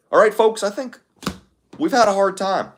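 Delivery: a hammed-up, off-target Obama; Obama impression. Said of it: "That's, that's not great".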